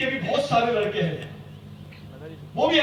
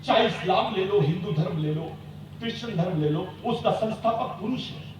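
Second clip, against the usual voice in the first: ordinary delivery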